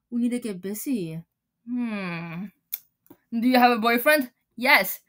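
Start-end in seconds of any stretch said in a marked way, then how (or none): none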